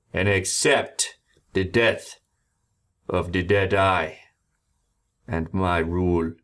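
slow, loud commanding threat; stern ultimatum. A narrator's reading. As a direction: lay down terms like a captain. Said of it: "Gale looks at everyone else and goes".

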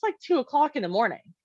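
usual speaking voice

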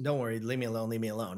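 low, quiet retold kid's mumble; sullen brush-off. Upset kid telling people to back off, low sullen brush-off. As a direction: imitate an upset kid shrinking away, hushed.

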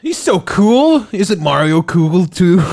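high, slow, loud gushing voice; mock gushing Miyamoto. Mocking Miyamoto praising the actor, high and drawn out. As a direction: gush like a starstruck fan. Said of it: "Miyamoto's like".

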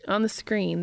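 ordinary delivery

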